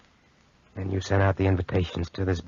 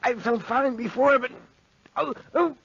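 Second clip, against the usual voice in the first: clutch at the pain as it hits; dying man's pained gasps; gasping in pain. Acted. Sudden pain, dying patient.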